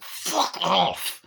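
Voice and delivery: drawn-out, high-pitched outburst; mock exasperation. Viewer to the daughter, mock exasperation. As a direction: stretch it out, hammed-up annoyance. Mock retort to film character.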